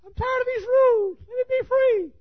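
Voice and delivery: very high little-dog voice begging; squeaky high dog voice pleading; talking Chihuahua. Acted. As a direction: whine to get out like a caged lapdog. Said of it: "Chihuahua said".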